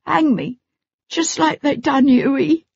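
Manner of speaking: put-on high boy's voice cracking with grief; voice breaking. A narrator's reading. Terrified boy breaks down remembering his brother. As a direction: let the voice break on it. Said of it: "Tom's voice broke".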